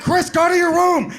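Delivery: high, loud fatherly order; father scolding his son; stern dad. Retold or imitated. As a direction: send him off sternly.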